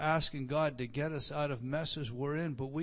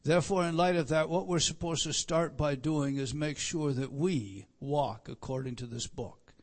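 normal talking voice

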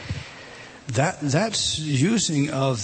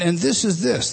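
ordinary delivery

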